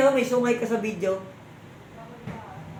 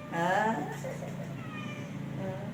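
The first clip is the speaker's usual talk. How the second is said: ordinary talk, nothing marked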